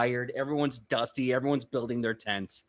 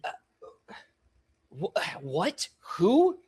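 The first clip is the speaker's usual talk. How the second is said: halting, then high incredulous questions; acted flustered confusion; confused and overstimulated; 5 semitones higher than usual. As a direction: splutter in bewilderment, pitch leaping up. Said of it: "so confused and overstimulated already. She's like".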